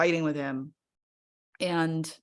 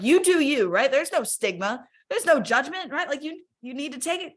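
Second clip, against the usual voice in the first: cheerleading friends; 9 semitones higher, 3 dB louder than usual. Retold or imitated. Encouraging friends about medication.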